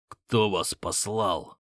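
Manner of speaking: slow, strained rasp; slow wounded croak; hoarse. Acted. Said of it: "С ещё более сильным хрипом спросил я".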